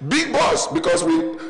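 sudden high excited burst; leaping high with excitement. Real, not acted. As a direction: pitch it way up, excited. Excited storytelling about the bus.

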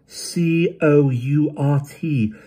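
each letter slow and high; slow spelling. A narrator's reading. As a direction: spell it out deliberately. Spelling out the word.

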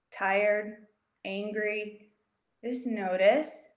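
slow, unhurried meditation voice; calm check-in with long pauses; guided meditation. Acted. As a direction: guide the kids gently inward, slowly. Leads a mindfulness check-in.

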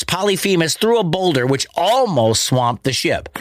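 ordinary delivery

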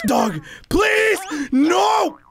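pleading shout. Real, not acted. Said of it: "I'm like".

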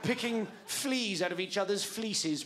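ordinary delivery

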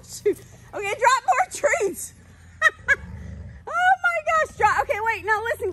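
high, excited urging to drop treats. Real, not acted. Owner urging her dog to drop treats, high and excited. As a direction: cheer the dogs on, pitch way up. Excitedly coaching dogs via app.

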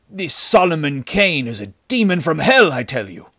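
loud, high, sullen protest. A narrator's reading. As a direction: insist heatedly, dropping at the end. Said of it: "The fellow spoken to replied sullenly".

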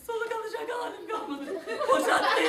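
high, breathless laugh-shout; laughing exasperation. Acted. Banker exhausted, laughing in disbelief. (bıkmış, nefesi kesik gülen adam)

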